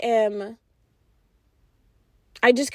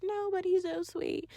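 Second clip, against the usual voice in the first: much higher, much softer, dreamy and lovestruck; smitten friend; 8 semitones higher, 14 dB quieter than usual. Retold or imitated. Friend defending her new man, dreamy and lovestruck. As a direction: coo it like she's hopelessly smitten. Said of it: "She would be like".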